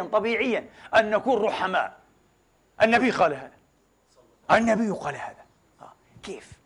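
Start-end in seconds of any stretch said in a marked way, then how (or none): 5.8-6.5 breathy little whisper to himself; whispered aside. Real, not acted. Preacher muttering a question to himself, whispered. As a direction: drop to a whisper.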